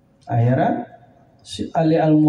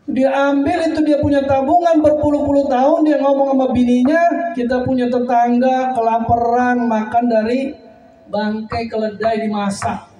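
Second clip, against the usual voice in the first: higher and louder, preaching in full force; fervent preaching; 9 semitones higher, 4 dB louder than usual. Real, not acted. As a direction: build to the emotional peak. Preacher's climax. (berkhotbah dengan semangat tinggi)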